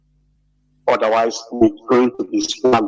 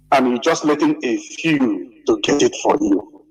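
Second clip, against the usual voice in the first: usual speaking voice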